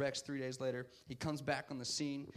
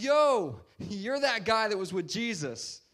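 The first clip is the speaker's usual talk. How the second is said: loud, drawn-out mock confrontation; imitating a pushy bystander; acted accusing call; 5 semitones higher, 7 dB louder, slower than usual. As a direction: call him out, slow and loud. Bystanders confront Peter in retold story.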